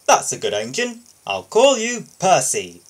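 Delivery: smiling boss. Acted. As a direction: beam as you name him. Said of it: "smiled the Fat Controller".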